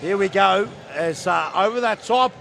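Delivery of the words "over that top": excited play-by-play. Real, not acted. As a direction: ride the attack with your voice. Play-by-play of attacking run.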